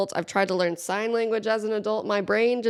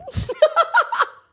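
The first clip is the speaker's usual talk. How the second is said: squealing burst of laughter; high laughing shriek; 6 dB louder than usual. Real, not acted. Joke punchline reaction.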